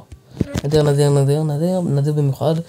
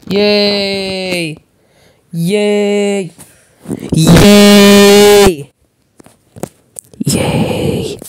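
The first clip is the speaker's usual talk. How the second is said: long drawn-out cheers, getting louder; excited long cheer; 5 semitones higher, 7 dB louder, much slower than usual. Real, not acted. Gamer cheering a win, building to a loud drawn-out yell.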